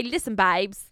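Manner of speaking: playful mock British accent; British accent, rallying the babes. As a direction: put on a cheeky UK accent. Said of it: "my little U K accent".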